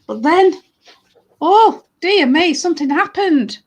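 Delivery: high, loud story narration at the turning point; suspenseful tale-telling with drawn-out words; dramatic storytelling. Acted. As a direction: build alarm for young listeners.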